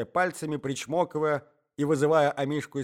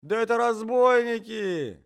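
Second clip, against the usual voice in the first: high, loud, drawn-out exclamation; alarmed outcry; 10 semitones higher, 6 dB louder than usual. A narrator's reading. Husband discovering intruders, alarmed outcry. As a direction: cry it out as if discovering robbers.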